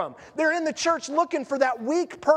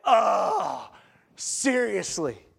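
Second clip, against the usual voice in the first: exasperated disbelief; 4 semitones lower, much slower than usual. Retold or imitated. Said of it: "you're like".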